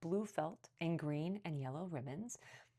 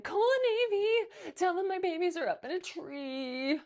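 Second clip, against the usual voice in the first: cat lady wailing for her babies; frantic cry; 13 semitones higher, 9 dB louder than usual. A narrator's reading. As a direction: shriek for her cats. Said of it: "she cried".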